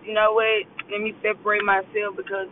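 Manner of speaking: usual speaking voice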